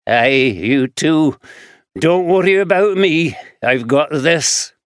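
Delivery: lightly voiced rough Glaswegian man; gruff Glaswegian drifter answering; Glaswegian accent. Fraser voiced.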